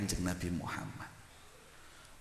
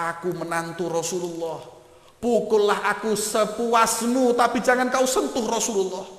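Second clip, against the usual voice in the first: impassioned plea; 10 semitones higher, 9 dB louder than usual. Acted. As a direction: offer your own back, desperate. Ali offers himself instead. (permohonan lantang penuh haru)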